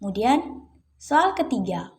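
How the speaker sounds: normal talking voice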